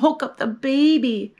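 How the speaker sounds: ordinary delivery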